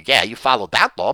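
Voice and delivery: ordinary talk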